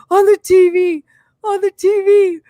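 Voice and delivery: put-on high, loud tearful kid voice; imitating a sobbing kid. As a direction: blurt it out between sobs like a small boy. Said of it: "came running into the bedroom bawling".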